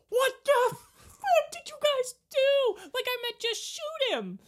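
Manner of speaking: high, loud, slow wailing complaint; distraught God. Retold or imitated. God watching his son crucified, wailing. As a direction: wail it like a father in disbelief.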